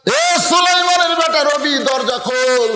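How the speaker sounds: high, drawn-out summons; calling at the door. Acted. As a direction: call out in a high preacher's chant. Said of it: "দরজা এসে ডাকতেছে".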